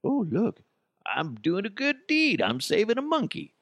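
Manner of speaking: high, airy mock do-gooder voice; mock selfie lady. Woman at the crash mocked as a show-off, high and smug. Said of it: "Be like".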